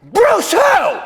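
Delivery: high, loud disbelieving outburst; stunned, high-pitched shout; incredulous shout. Acted. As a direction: yell it in total disbelief. Stunned reaction to famous fans' names.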